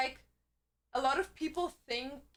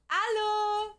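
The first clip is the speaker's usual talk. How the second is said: high, stretched French greetings; over-extravagant French; 5 semitones higher, 4 dB louder, much slower than usual. Shown as a demonstration. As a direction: ham up the French, holding the vowels. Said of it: "over extravagantly speak when I speak French".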